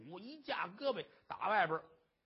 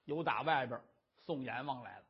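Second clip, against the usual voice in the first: usual speaking voice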